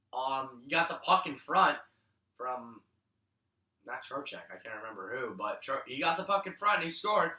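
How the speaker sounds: ordinary talk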